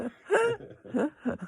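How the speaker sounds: high, loud burst of laughter at her own slip; big laugh. Real, not acted.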